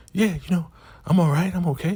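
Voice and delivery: high-pitched, giddy put-on voice; imitated giddy woman. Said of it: "laughing and chuckling".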